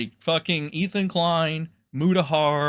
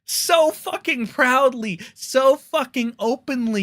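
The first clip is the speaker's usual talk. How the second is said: furious disbelief; angry rant; 11 semitones higher than usual. Real, not acted. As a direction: rail at him in outrage. Angry rant at Ethan Klein.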